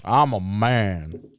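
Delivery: mock macho drawl. Macho brag after opening.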